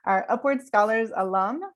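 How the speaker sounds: ordinary talk, nothing marked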